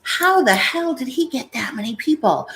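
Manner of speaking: baffled, high-pitched exclamation; incredulous. Retold or imitated. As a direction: marvel in disbelief at it. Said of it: "I've always been like".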